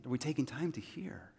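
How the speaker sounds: hushed, breathy whisper to the congregation; whispered question. Acted. Pastor whispers the question to his church, hushed. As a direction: lean in and whisper it.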